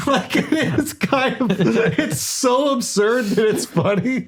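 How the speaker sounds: slow, high voice breaking into real laughter; laughing in disbelief. Real, not acted. Podcaster struck by an absurd case, laughing through the words. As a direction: laugh helplessly through the words, drawing them out.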